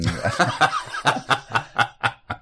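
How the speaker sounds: a short real laugh at his own story. Real, not acted. Telling a friend about a coming-out mix-up, laughing. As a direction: laugh at how absurd it was.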